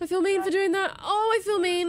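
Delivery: loud, high cry of remorse; playfully distraught outburst; guilty wail. Real, not acted. Feels bad after catching creature.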